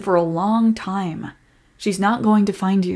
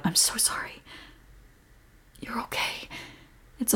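soft, close whisper of reassurance; comforting whisper; 3 semitones higher, 14 dB quieter than usual. Acted. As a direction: whisper to calm him down. Whispered comfort after apology.

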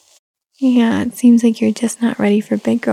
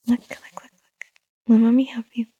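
whispering. Real, not acted. Hushing fussing baby.

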